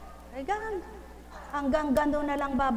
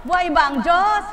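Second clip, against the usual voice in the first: preacher call; 4 semitones higher, 7 dB louder than usual. Real, not acted. Preacher calls out.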